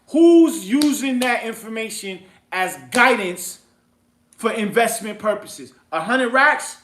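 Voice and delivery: loud, high, scoffing questions; incredulous rant. Real, not acted. Investor scoffing at betting money on Trulia, incredulous. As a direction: build to a high, loud outburst of disbelief.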